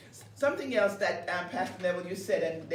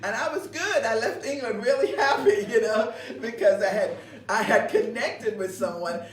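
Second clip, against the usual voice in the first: louder, laughing through the words; laughing happily; 3 semitones higher, 8 dB louder than usual. Real, not acted. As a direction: gush about it while laughing. Recalling falling in love.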